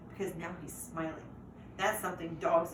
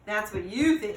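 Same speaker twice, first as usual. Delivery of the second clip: louder knowing warning; 8 dB louder than usual. A narrator's reading. Older dog warns the puppy, louder knowing tone. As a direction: warn him, sure of yourself. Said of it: "Frankie warns".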